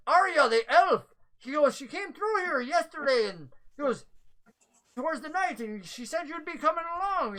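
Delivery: high, anxious NPC voice; flustered messenger. Acted. Flustered man explains lost message.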